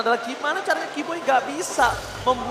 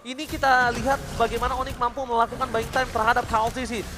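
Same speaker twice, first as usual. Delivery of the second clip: usual speaking voice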